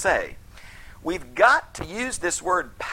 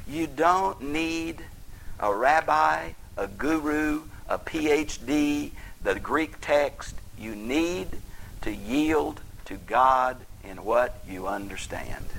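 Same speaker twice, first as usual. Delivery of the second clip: slow emphasis; slower than usual. Real, not acted. Slow emphatic pulpit list.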